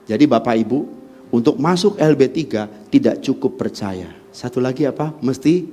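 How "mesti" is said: normal talking voice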